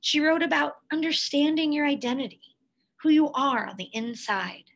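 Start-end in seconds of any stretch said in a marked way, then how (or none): none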